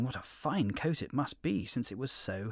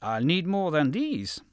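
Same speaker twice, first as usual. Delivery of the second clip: wolf voice; 5 semitones higher, 7 dB louder than usual. A narrator's reading. Wolf demands more lambs.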